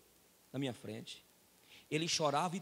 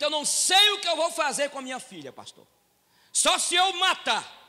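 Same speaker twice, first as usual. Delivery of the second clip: raised, ringing pulpit voice, higher and louder; fiery preaching; 8 semitones higher, 9 dB louder than usual. Acted. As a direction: preach it out to the whole church. Pastor preaching at full force.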